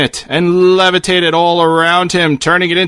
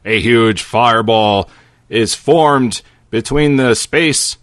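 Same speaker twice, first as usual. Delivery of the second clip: ordinary delivery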